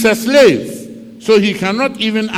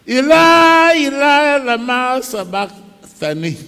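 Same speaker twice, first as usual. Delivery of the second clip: loud, high cry that fades by the end; anguished cry; 7 semitones higher, 4 dB louder than usual. Acted. Jesus on the cross calls out to God, anguished. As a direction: cry out in agony, then trail off. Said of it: "obi so ɔkae sɛ".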